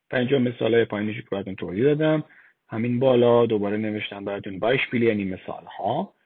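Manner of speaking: ordinary delivery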